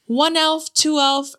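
high, singsong mock counting; counting elf. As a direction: imitate a store elf tallying heads. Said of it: "And she's like".

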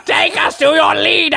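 high shouted alien voice. Pretend. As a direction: bellow it like a movie alien. Playing alien on stage.

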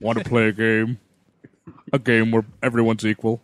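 low, menacing Jigsaw voice; lightly voiced creepy puppet killer; a hint of sinister game-master menace; Jigsaw impression. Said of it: "Jigsaw is definitely gonna desubscribe".

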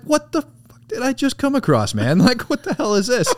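high, laughing shock; laughing disbelief. Retold or imitated. Soldier finds the naked man, high laughing disbelief. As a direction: crack up while asking what this is.

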